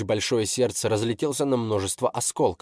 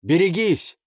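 shouting while wounded; 8 semitones higher, 5 dB louder than usual. Acted. Warning the boy, wounded.

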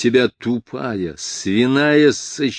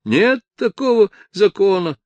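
put-on high, loud drunken protest; drunken police chief; 11 semitones higher, 5 dB louder than usual. A narrator's reading. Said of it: "сказал пьяный голос полицмейстера".

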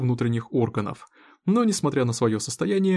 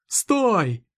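sharp, higher shout to stop; 7 semitones higher than usual. Acted. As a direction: yell it to stop him. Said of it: "Милт закричал". (крик «Стой!»)

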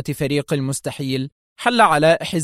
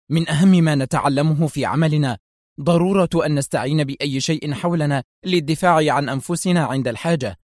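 usual speaking voice

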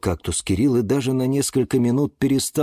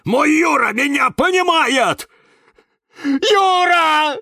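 very high, loud wailing insistence; yelling; 7 dB louder, slower than usual. A narrator's reading. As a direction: wail for your friend at full voice. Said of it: "Опять перешёл на крик". (истошный крик)

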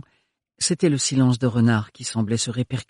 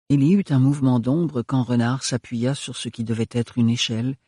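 ordinary delivery

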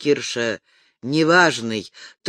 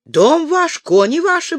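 put-on much higher, mumbled old Tatar trader with broken Russian; a Tatar man muttering in a much higher voice; 12 semitones higher, 4 dB louder than usual. A narrator's reading. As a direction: mumble it high and eager like the Tatar horse-trader. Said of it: "бормотал татарин".

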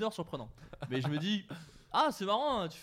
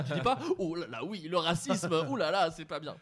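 hammed-up fussy alarm; 3 semitones higher, 4 dB louder than usual. Shown as a demonstration. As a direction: ham up the oh là là like a fake scandal. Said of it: "normalement, tu dis". (imitation exagérée, faussement choquée)

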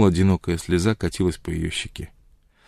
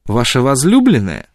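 higher, louder mocking question; caustic, needling query; biting sarcasm; 4 semitones higher, 5 dB louder than usual. A narrator's reading. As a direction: taunt him about his sweetheart. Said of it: "язвительно спросила она".

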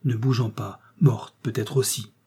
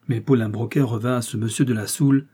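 ordinary delivery

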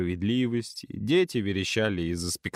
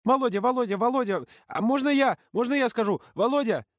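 put-on clamoring kids; 12 semitones higher than usual. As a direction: beg for attention like small kids. Little kids clamoring for leader.

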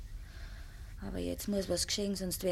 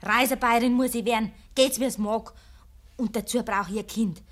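defiant; 3 semitones higher, 7 dB louder than usual. Acted. Trotzig entschlossen.

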